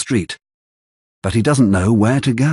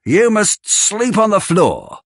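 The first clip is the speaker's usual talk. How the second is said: high, bossy dismissal; high mean woman banishing the boy to the floor; Missus Sowerberry, dismissive; 11 semitones higher than usual. Acted. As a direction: order him off like a servant. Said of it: "says Missus Sowerberry".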